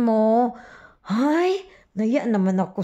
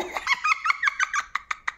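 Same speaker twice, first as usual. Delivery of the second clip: shrill sneering cackle, quieter; high mocking laugh; 5 dB quieter than usual. Real, not acted. Vlogger laughing at her rival, high mocking cackle.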